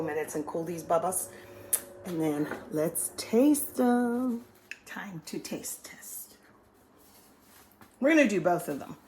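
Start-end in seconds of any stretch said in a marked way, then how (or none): 4.8-6.4 slow whispered announcement; whispering. Real, not acted. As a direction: whisper it slowly, conspiratorially. Whispered, slow, before tasting.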